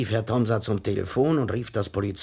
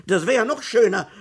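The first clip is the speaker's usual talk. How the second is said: high, loud and outraged, climbing higher to the end; an offended citizen scolding the police; indignant tirade; 11 semitones higher, 5 dB louder than usual. A narrator's reading. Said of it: "ereiferte sich Herr Tomsa". (empörte, laute Tirade)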